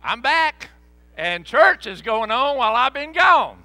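loud, slow, wondering cry; dazed excitement. Retold or imitated. Preacher retelling waking from his trance: loud slow wonder. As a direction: come to as if waking up amazed. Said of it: "and I was like".

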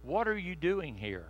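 soft, gentle question; a quiet, intimate whisper of a question; still small voice. Shown as a demonstration. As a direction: let it drop to a hush. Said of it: "with a still small voice".

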